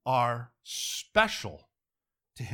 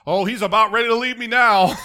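mock despair; 7 semitones higher, 13 dB louder than usual. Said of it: "feeling like crap".

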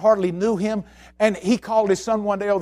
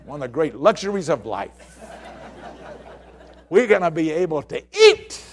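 laughing; 4 semitones lower than usual. Real, not acted. Laughing about eating.

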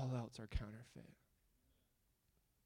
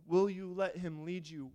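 louder, higher pleading question; urgent louder plea; 5 semitones higher, 6 dB louder than usual. Real, not acted. Pastor urging the congregation to follow, rising plea. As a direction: press the question home with force.

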